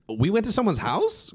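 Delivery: high, incredulous question; retold disbelief. Shown a photo by cousin, retold baffled surprise.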